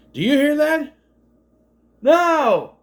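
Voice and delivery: high, loud mock yell over the rotor noise; yelling over a helicopter. Couple yelling over the helicopter noise, mock shout. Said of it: "he's gotta yell over anyway".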